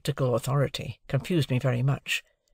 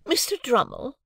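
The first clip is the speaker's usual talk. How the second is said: much higher, affronted lady voice; a proud wife refusing all interference; high indignant lady voice; haughty Mrs Pocket; 12 semitones higher than usual. A narrator's reading. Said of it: "said Missus Pocket".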